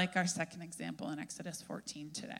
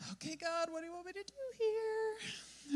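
much higher, softer, holding it together; brave face; 11 semitones higher, 7 dB quieter than usual. Retold or imitated. Moses quietly asks God, mock brave composure. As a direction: keep your voice up and steady despite the fear. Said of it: "put on a brave face".

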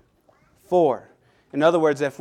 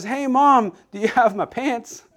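put-on little boy voice; 6 semitones higher than usual. Tiny boy asks his mom.

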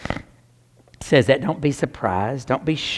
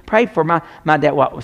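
ordinary talk, nothing marked

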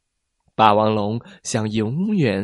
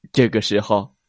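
usual speaking voice

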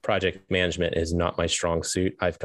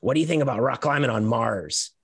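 a high, nasally voice, mildly imitated; imitating a nasally voice; 5 semitones higher than usual. Engineer on the phone pitching Mars, mild nasally imitation. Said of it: "this real nasally voice got on the phone".

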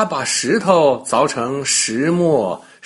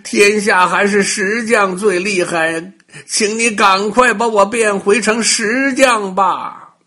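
high, loud yelps of pain easing into a plea; a crying shout for rescue that settles into begging; 7 semitones higher, 5 dB louder than usual. Acted. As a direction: howl for help as the chisel bites. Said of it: "王大锤疼得大叫起来".

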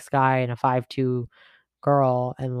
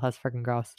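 ordinary delivery

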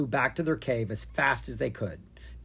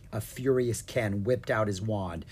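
usual speaking voice

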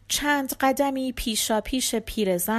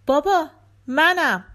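calling through a door; 6 semitones higher, 7 dB louder than usual. Acted. Father calls through the door.

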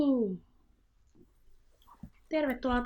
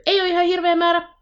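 high, loud and fast, fed up; exasperated outburst; 9 semitones higher, 7 dB louder, faster than usual. Real, not acted. Friend venting about a game, high, loud, exasperated. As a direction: blurt it out in exasperation.

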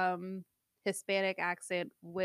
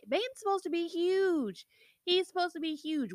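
incredulous protest; 10 semitones higher than usual. Retold or imitated. Incredulous complaint about miscast Bane.

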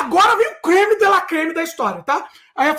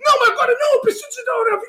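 much higher, strained yell; about to lose it; frantic angry cry; 8 semitones higher, faster than usual. Retold or imitated. Grito de raiva contido. (gritando descontrolado)

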